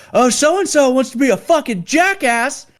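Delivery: high, loud sneering instructor impression; mock the recruit in front of the platoon; shrill mocking sergeant voice; mocking drill instructor. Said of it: "you know, or fucking just like".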